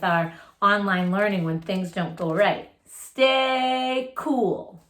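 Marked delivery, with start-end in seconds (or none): none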